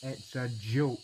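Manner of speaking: ordinary delivery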